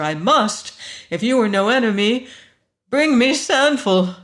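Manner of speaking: loud, ringing, commanding woman. A narrator's reading. Woman accuses stranger and demands Sanfel, ringing and insistent. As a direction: let the accusation and plea ring out with urgency. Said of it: "The voice rang like a bell".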